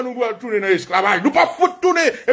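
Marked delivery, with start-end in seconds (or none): 1.2-2.1 defiant refusal. Acted. Slaves' refusal acted out.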